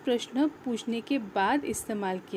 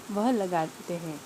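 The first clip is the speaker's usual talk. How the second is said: ordinary delivery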